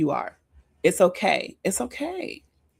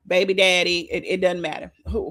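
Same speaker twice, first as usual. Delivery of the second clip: ordinary talk, nothing marked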